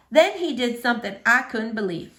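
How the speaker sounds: ordinary delivery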